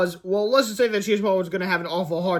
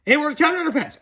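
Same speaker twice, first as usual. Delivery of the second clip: high character voice; 9 semitones higher, 5 dB louder than usual. Acted. Character voice in read story.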